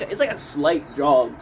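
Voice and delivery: normal talking voice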